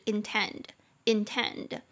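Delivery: intend said slowly, stress on tend; slow syllable-by-syllable intend. Shown as a demonstration. Teacher drilling intend slowly for students, stress on tend. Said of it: "两个音节".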